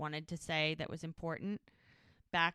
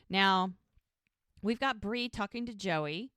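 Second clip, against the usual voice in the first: ordinary talk, nothing marked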